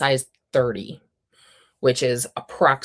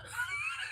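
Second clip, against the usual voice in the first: high, breathy burst of laughter; sudden laugh; 15 dB quieter than usual. Real, not acted. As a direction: crack up at your own joke. Laughing at Canva comparison.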